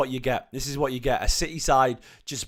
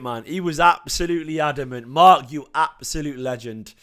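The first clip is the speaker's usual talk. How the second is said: usual speaking voice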